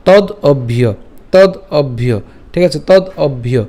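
"তদ", clear pause, then "অভ্য"; teacher breaking "তদ অভ্য" in two; split pronunciation. Shown as a demonstration. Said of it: "একটু ভেঙে দিয়েছি". ("তদ অভ্য" থেমে ভেঙে উচ্চারণ)

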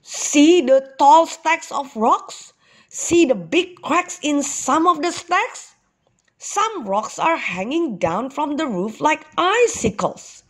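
excited showing. A narrator's reading. Father shows son the beautiful rocks, excited wonder.

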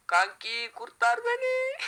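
very high squeaky plea for help. Pretend. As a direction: squeal it like a cartoon. Playful cry for help in game.

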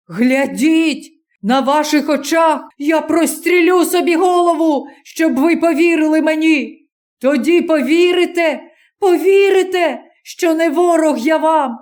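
high, straining, frantic cry; desperate young man. Acted. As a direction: beg them through the threat. Threatens to shoot himself.

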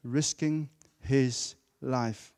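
very slow, each word drawn out; drawn-out emphasis on risking. Shown as a demonstration. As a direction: stretch out risking his life. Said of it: "Highlight the word".

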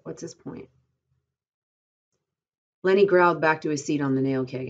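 plain reading voice

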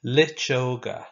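slow, clear Irish word for the class; slow model word. Shown as a demonstration. Teacher modelling a new Irish word slowly. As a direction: drag each syllable out for them to copy. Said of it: "Now you say it after me".

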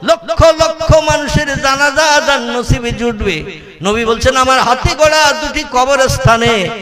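high, ringing sermon chant; melodic preaching. Acted. Preacher relaying the Prophet's promise to the faithful, melodic preaching. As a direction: intone it like a rousing preacher.

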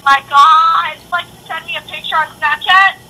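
normal talking voice

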